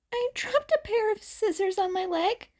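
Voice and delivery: put-on high, fast, flat-out fib from the young hero; nervous youth blurting a flimsy lie; blank deadpan answer. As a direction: rattle off the excuse quickly, like it's nothing. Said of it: "he responded blankly".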